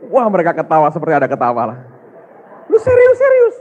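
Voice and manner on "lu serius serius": laughing disbelief. Retold or imitated. Pastor retells laughing friends.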